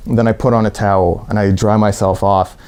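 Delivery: usual speaking voice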